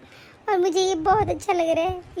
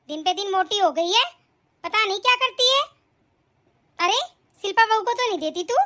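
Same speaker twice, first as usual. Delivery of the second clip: ordinary delivery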